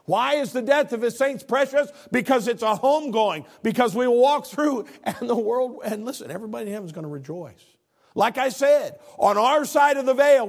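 high, loud preacher's cry; full-force preaching. Acted. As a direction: preach it out to the whole church. Preaching climax.

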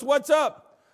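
high, loud casual greeting; God answering offhand. Retold or imitated. Pastor voicing God's casual reply. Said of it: "God goes".

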